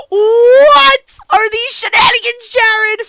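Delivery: loud, high, slow outcry; real mock-outraged yell at the screen; outraged shout. Real, not acted. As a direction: holler it like a betrayed fan. Outraged reaction to the twist.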